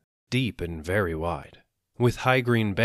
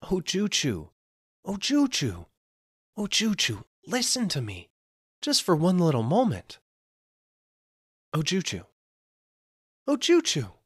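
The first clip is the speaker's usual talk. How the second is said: much higher, desperate repeated calling; pleading; 11 semitones higher than usual. Acted. Merchant pleads over and over with the turned-away woman. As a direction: beg her again and again, voice climbing. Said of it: "and pleaded".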